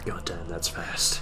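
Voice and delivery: slow awed whisper. Pretend. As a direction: breathe it out in mock awe. Listener's awed aside.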